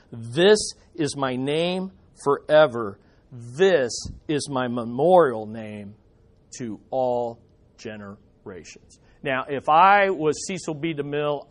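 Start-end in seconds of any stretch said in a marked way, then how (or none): 0.1-9.0 God's voice. Acted. God declares his name.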